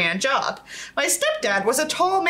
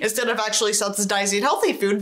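ordinary delivery

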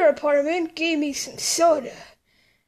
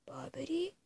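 soft unvoiced whisper; 3 semitones lower, 16 dB quieter than usual. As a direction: breathe the name in a whisper. Whispered name.

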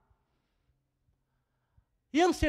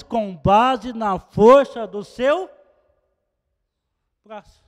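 preaching; 3 semitones higher, 10 dB louder than usual. Acted. Preacher warning the church about anxiety, building and forceful. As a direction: drive the warning home to the congregation.

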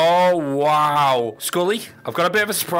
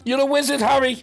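lightly voiced Hagrid line; a hint of Hagrid, higher; Hagrid quote; 9 semitones higher than usual. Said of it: "Or should I say".

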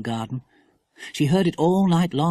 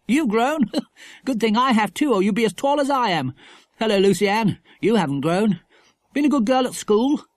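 put-on higher, lively boy's voice; chummy brother, pitched up; jaunty schoolboy; 8 semitones higher, 4 dB louder than usual. A narrator's reading. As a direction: tease the girls cheerily. Philip greets the girls.

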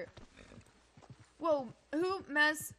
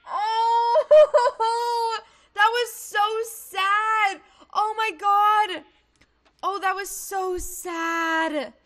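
loud, high, drawn-out moan; tearful-sounding, stretched-out lament; upset wailing; 7 semitones higher, 9 dB louder, much slower than usual. Real, not acted. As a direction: wail slowly with heartbreak. Wailing after killing game animal.

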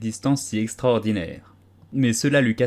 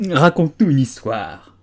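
ordering; 4 semitones higher, 7 dB louder than usual. A narrator's reading.

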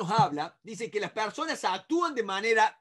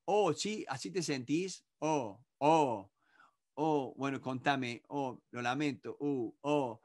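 much softer, lower comforting murmurs; soothing sympathy; 6 semitones lower, 6 dB quieter than usual. Shown as a demonstration. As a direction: console quietly, slow and low. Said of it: "Eso es lo único que tengo que decir". (consuelo suave y grave)

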